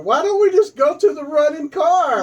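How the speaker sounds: high, loud mock-panicked plea; panicked movie victim. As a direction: plead like a hammed-up horror victim. Acting out horror-movie commercial victim.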